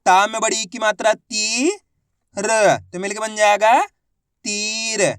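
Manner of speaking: long rising "ती", separate "र", then stretched "तीर"; letter-by-letter sounding out. Shown as a demonstration. Teacher repeating the method for learners, sounding out and blending "तीर". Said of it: "एक बार फिर से देखिए".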